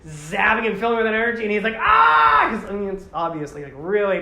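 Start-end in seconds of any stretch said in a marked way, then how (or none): 1.8-2.5 sudden very high, loud cry of pain; hammed-up pain scream. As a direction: let out a sharp agonized yell. Character in pain, retold.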